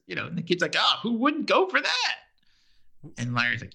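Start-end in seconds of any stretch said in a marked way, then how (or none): 0.8-2.2 high-pitched, imitating an excited kid; retold youthful enthusiasm; mock eager kid. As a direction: jump at the offer in a raised voice. Voicing an eager kid's reply.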